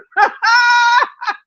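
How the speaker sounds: high shrieking laugh. Real, not acted. Streamer to chat, shrieking with laughter at the trolls. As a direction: let out a shrieking cackle.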